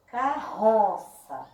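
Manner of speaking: "Carroça" slowly, leaning on RO; stressed middle syllable. Shown as a demonstration. Teacher repeating the example for pupils, slow "Carroça" with stressed RO. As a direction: draw out RO in "Carroça". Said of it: "o som que saiu mais forte".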